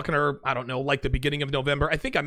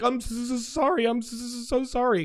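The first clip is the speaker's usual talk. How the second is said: higher, stuttering mock apology; stammering apology; 8 semitones higher than usual. Girlfriend apologizing for coming too soon, higher stammering mock apology. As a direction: imitate her flustered stammered sorry. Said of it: "She'd be like".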